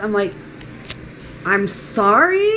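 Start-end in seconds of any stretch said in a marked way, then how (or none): 1.4-2.5 drawn-out incredulous question; slow, rising offended disbelief; retold "excuse me?" reaction; taken aback. Retold taken-aback reply to maid.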